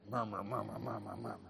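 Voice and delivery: low, very soft repeated grumble; mock muttering. People frustrate you and nobody hears, mock muttering. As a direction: mumble it under your breath in imitation. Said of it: "you mutter to yourself".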